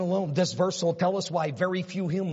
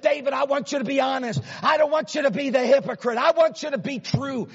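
higher, louder scolding voice of God; voicing God; 6 semitones higher, 4 dB louder than usual. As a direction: let God rebuke David, rising. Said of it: "He said".

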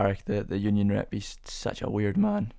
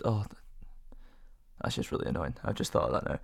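muttered aside; 9 semitones lower, 11 dB quieter, faster than usual. Real, not acted. Sudden annoyed realisation, muttered.

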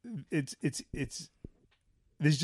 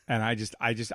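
normal talking voice

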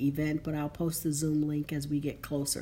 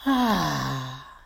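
loud, open release of breath; loud sigh; 4 semitones lower, 5 dB louder than usual. Shown as a demonstration. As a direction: let it all out in a big sigh. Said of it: "let the breath out with a loud sigh".

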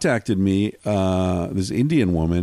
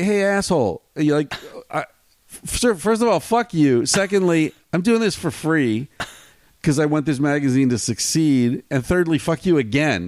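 imitating his own angry email reply; retold telling-off; 6 semitones higher than usual. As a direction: tell the hacker off point by point. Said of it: "And I was like".

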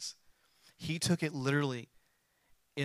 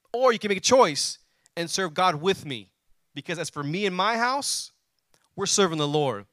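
full-force preaching; 4 semitones higher, 6 dB louder than usual. Acted. Pastor reading Joshua to the congregation, preaching hard. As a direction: thunder the verse out to the congregation.

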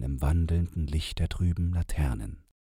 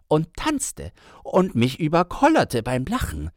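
agitated storyteller man; 5 semitones higher, 4 dB louder than usual. Acted. Man tells his story.

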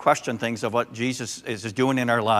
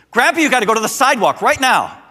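put-on much higher and louder child's order; bossy granddaughter; 11 semitones higher, 9 dB louder than usual. Granddaughter ordering grandpa onto the sidewalk, high and insistent. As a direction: boss grandpa around like a toddler. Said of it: "She goes".

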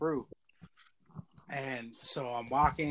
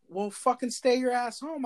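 high, slow, louder scolding; drawn-out retold reprimand, pitched up; scolding cop; 9 semitones higher, 5 dB louder than usual. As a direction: imitate an exasperated cop's telling-off. Cop scolds him in retelling.

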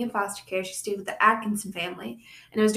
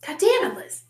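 raised, louder playful scolding; higher, louder exasperated outburst; mock exasperation; 9 semitones higher, 4 dB louder than usual. Real, not acted. Playfully scolding co-host over warnings.

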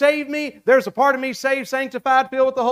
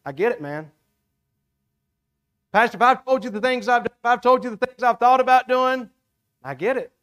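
reassuring. Retold or imitated. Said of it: "I'm just like".

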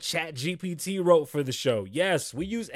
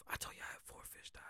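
whispering; 24 dB quieter, much faster than usual. Real, not acted. Aside said under breath.

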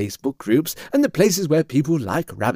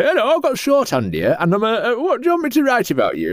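high-pitched, hurried put-on voice; squeaky little interviewer character; eager Wikipedia reporter; 11 semitones higher than usual. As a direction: play a comic note-taker, pitched way up. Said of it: "Wikipedia come along with a pencil".

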